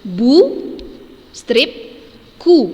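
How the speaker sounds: Bu, strip, ku said loud with clear pauses between syllables; teacher splitting the word loudly; syllables separated, loud. Shown as a demonstration. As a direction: break buku into bu and ku. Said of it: "Nah, ini dia".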